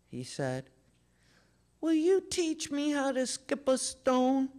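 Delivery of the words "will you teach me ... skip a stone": put-on small boy. Little brother asks.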